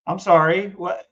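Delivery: usual speaking voice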